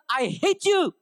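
acted-out tantrum line; angry kid's outburst. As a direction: imitate a furious child hurling it at mom. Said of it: "In his anger".

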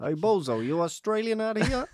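high-pitched New York heckle; hammed-up New York street voice; mock New York accent. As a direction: challenge them like a cartoon New Yorker. Turns the quote into New Yorker.

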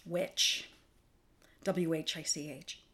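Witch whispered, soft and eerie; spooky whispered witch. Shown as a demonstration. Host whispers Witch, the broom-riding kind, spooky. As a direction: breathe Witch like a spell. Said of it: "like the lady who rides around on a broom".

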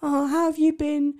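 high, slow mock girly greeting; high slow drunk girl imitation. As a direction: put on a high, drawn-out girly voice. Girl voiced in story.

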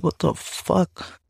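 slow, high, hushed disbelief; stunned disbelief. Retold or imitated. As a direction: draw it out softly and high, stunned at the discovery. Acting out own shocked reaction.

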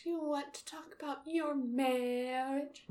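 soft, high counselor voice; gentle therapist voice. Retold or imitated. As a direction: ask it like a kindly therapist. Isis as therapist.

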